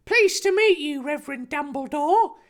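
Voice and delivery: put-on high eager boy's voice; enthusiastic Harry. Harry greeting the Reverend, eager high voice. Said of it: "Harry replied enthusiastically".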